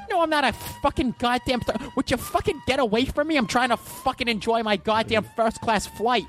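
high-pitched comic tirade; Bill Burr. Acted. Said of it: "Just play the podcast then".

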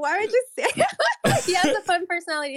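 high, fast laughing protest. Real, not acted. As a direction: giggle through the words. Teasing through laughter.

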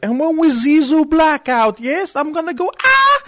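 hammed-up German rocker explaining his moves; German accent. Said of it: "in his German accent is like".

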